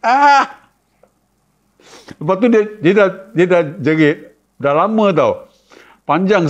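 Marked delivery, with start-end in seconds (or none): 0.0-0.7 very high, loud siren-like shriek; siren scream. Retold or imitated. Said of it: "Macam bunyi siren". (jeritan kuat macam siren)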